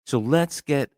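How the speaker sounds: low, soft and grumpy, already fed up; bad mood. Real, not acted. Host warning listeners of a rant, grumpy and low. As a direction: grumble it out, sour before the rant starts. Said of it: "as you could hear from the tone in my voice".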